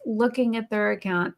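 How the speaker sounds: ordinary delivery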